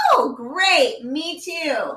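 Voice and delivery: high, loud, drawn-out swoop on the quote; big commiserating exclamation, imitated; mock-enthusiastic reply. Cohost answering that her kids drive her nuts too, mock-enthusiastic.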